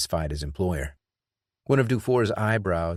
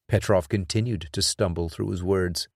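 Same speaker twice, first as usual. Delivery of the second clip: ordinary delivery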